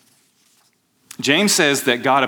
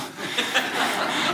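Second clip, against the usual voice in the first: breathy chuckle; 11 semitones higher, 8 dB quieter than usual. Real, not acted. Pastor laughs at own impression.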